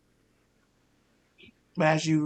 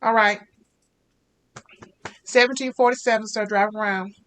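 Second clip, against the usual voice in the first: high, loud, bright-voiced fast-food worker; over-eager drive-thru cashier greeting the car; chirpy drive-thru cashier; 5 semitones higher, 5 dB louder than usual. Cashier Rebecca gives total.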